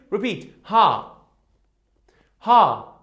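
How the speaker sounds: ha said as an open loud hah; letter sound demo. Shown as a demonstration. Teacher sounding out the letter for pupils, loud. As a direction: call out ha loudly, open mouth.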